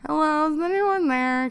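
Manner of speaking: acted cry into an empty room; calling out again. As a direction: call out to whoever might hear. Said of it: "You cough and try again".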